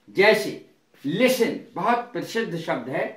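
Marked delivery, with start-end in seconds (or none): 1.0-1.6 लिसन with S running straight into N; silent T. Shown as a demonstration. Teacher giving listen as example, लिसन with silent T. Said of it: "तो टी silent रहता है".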